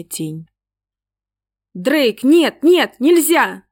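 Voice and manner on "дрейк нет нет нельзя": high, loud frantic cry at the dog; screaming no at the dog; yelling. A narrator's reading. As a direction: shout the dog off in panic. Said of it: "закричала и замахала она".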